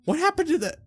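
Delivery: ordinary delivery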